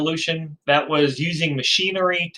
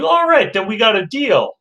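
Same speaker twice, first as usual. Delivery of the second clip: pleased salesman; 7 semitones higher, 3 dB louder than usual. Acted. Seller seals the deal.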